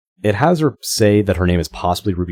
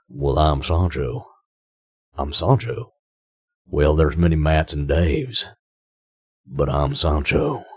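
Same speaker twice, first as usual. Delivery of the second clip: slow, lower, drawn-out Sam Elliott cowboy voice; Sam Elliott impression; 3 semitones lower, 4 dB quieter, slower than usual. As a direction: lean back and drawl it out like Sam Elliott. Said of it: "him just sitting there like".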